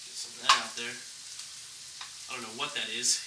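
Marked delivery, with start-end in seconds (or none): none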